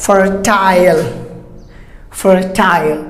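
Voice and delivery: fertile with a long "isle" ending; British-style fertile, rhyming with tile; British pronunciation. Shown as a demonstration. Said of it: "British will go for isle sound".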